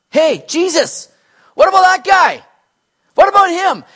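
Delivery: high, loud, acted demand; indignant calling out. Peter spots John following and calls to Jesus, indignantly loud. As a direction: shout it as a hammed-up deflection.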